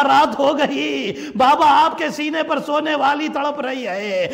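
high, keening cry for a lost father; tearful child's lament. Acted. Sakina telling her aunt of searching for her father, tearful wail. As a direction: wail it as the weeping girl, drawn-out. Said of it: "Sakina رو کر کہتی".

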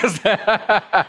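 chuckling at own joke; real amused laughter. Real, not acted. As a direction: laugh it off after the punchline.

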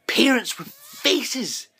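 high, slow ironic emphasis; dry sarcasm; sarcastic. Real, not acted. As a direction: lean on it with mock wonder. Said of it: "ironic emphasis".

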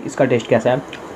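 chewing between words; talking while biting food; mouth full of pizza. Real, not acted. Seen 0: Bites pizza at +0.3 to +0.8 and +1.7 while speaking.

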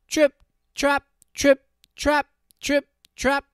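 high, loud, crisp hoof-step chant; lighter hoof beats. A narrator's reading. Hooves of the middle goat on bridge.

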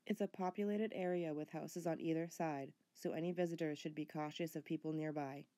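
documentary voiceover. A narrator's reading. Voiceover narration of location.

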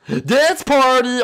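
loud, high burst-in yell; big high-pitched announcing shout; party shout. Pretend. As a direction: holler it drawn out to the whole room. Bursting in on players.

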